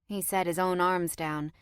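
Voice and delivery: usual speaking voice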